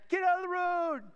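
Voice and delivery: joking yell. Retold or imitated. Rider hollering from the van at cyclists, joking yell. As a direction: bellow it like heckling from a passing van. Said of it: "We're yelling in the van".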